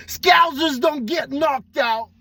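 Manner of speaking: high, hammed-up Scouse accent attempt; a Scouse accent that drifts German; bad Scouse accent. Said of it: "I sound more German than I sound English".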